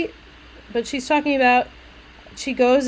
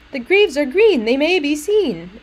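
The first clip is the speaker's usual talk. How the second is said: bouncy rhyme, higher and louder; sing-songy; 6 semitones higher, 7 dB louder than usual. Shown as a demonstration. Teacher showing the poem's tone, sing-songy. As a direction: lilt it to make the rhyme ring. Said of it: "the tone is sing-songy".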